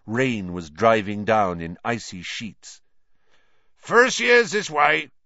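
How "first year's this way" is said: loud, high, drawn-out call. A narrator's reading. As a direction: call slowly to a crowd across a platform. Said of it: "called a familiar voice".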